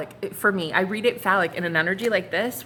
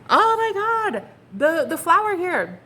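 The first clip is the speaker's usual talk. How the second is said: amazed; 10 semitones higher, 5 dB louder, slower than usual. Real, not acted. Amazed at card.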